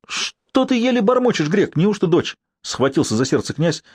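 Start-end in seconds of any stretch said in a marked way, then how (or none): 0.1-2.4 anxious demand. A narrator's reading. Prince demands news of birth.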